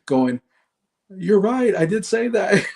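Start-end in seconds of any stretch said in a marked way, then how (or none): 1.1-2.8 imitating the wife, high-pitched and amused; higher voice breaking into a laugh at the end; laughing admission. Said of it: "And she's laughing at it".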